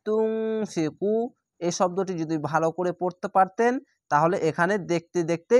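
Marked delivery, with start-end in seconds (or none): 0.1-1.1 তুনফিকু with nasal ghunna and the ku stretched; Quranic recitation. Shown as a demonstration. Teacher recites the word for students, drawn out. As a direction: recite তুনফিকু slowly, drawing out each sound. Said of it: "এখানে দেখেন".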